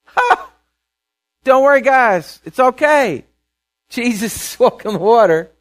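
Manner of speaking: high, loud breezy all-is-well voice; a disciple cheerfully telling the others not to worry, hammed-up calm; mock cheerful reassurance. Bright sing-song mock comfort. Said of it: "no one said".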